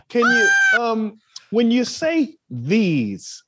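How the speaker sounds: drawn-out, very slow query; mock slow question. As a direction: stretch every word, baffled. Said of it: "mock actor asking slowly".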